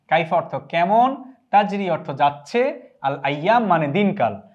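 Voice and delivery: normal talking voice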